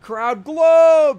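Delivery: loud, high hype shout; hyped crowd call. Acted. Streamer playing the crowd reacting to [name], loud excited shout.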